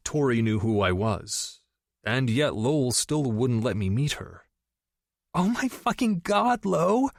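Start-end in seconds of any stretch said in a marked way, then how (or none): 5.4-7.1 high excited scream of a gushing friend; shrieking friend. Acted. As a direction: squeal it like you just saw big news. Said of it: "screamed from the computer".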